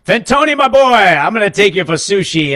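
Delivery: high, loud, hearty fatherly call; booming dad imitation. Dad treating the boy to sushi, high booming hearty voice. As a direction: ham up the big-hearted dad with a grand invitation. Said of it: "he was like".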